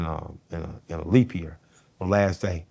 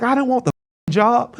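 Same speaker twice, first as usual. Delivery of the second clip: defensive; 6 dB louder than usual. Retold or imitated. Retort to mother, high.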